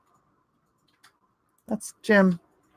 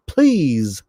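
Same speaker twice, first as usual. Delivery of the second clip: ordinary talk, nothing marked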